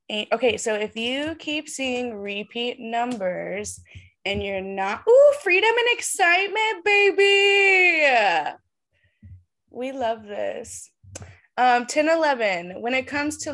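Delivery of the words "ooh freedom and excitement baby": much higher and louder, almost a shout; excited. Real, not acted. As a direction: cheer the news out. Excited reading of meaning.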